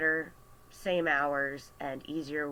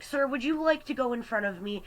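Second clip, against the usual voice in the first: higher, louder mock-polite offer; fed-up customer; 8 semitones higher, 5 dB louder than usual. Fed-up customer to the complainer behind her, exasperated.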